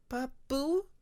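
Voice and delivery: put-on very high, soft little child voice; soft child question. Bobcat softly asking his father what is wrong. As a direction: ask gently like a worried little one. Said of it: "Bobcat asked softly".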